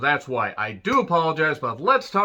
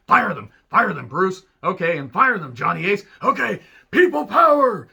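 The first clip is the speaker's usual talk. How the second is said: mock boss; 3 semitones higher, 5 dB louder than usual. Mock boss firing wrestlers.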